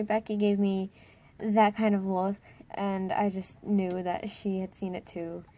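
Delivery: usual speaking voice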